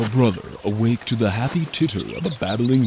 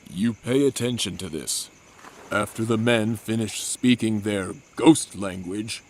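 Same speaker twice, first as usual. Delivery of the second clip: normal talking voice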